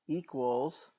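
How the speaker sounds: ordinary talk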